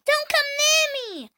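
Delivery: high, loud duckling shout. Acted. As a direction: push the ugly brother away with a yell.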